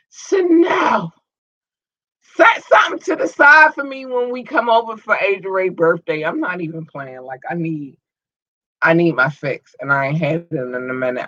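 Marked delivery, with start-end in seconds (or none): 0.1-6.2 shouting her name across the room; calling out. Real, not acted.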